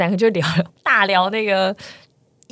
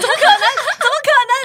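laughing, higher and louder on the repeat, slowed; laughing disbelief; 11 semitones higher, 4 dB louder, slower than usual. Retold or imitated. To the stranger who says she is not A, laughing in disbelief. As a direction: laugh it off, refusing to believe. Disbelief at being told wrong person.

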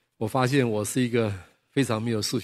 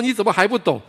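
angry retort; 8 semitones higher, 6 dB louder than usual. Retold or imitated. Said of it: "我们两个都很生气".

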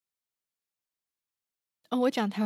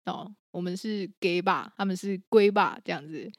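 usual speaking voice

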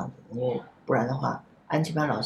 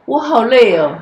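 loud tearful wail, pitched up; crying out; 7 semitones higher, 11 dB louder than usual. Retold or imitated. The boy breaks down to the principal, loud crying wail. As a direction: cry it out like an exhausted child. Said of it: "他就哭好的叫了".

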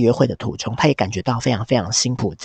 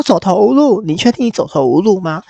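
scornful disbelief; 7 semitones higher, 4 dB louder than usual. Retold or imitated. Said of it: "Hank听到就是大笑".

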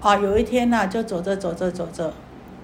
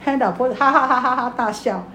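usual speaking voice

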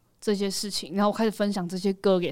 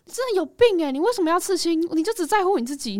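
high-pitched, accusing parental scolding; retold reproach, pitched well up; guilt-tripping parent; 9 semitones higher than usual. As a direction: imitate a worked-up parent laying on guilt. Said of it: "那种父母亲情绪勒索式".

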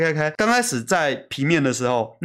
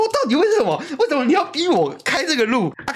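retold angry outburst; 11 semitones higher than usual. Recalling his rage at driving through the typhoon, high exasperated cry. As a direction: yelp it out in mock despair. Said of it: "我说".

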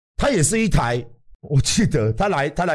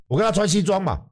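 ordinary delivery

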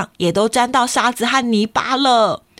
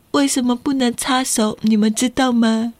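ordinary delivery, nothing marked

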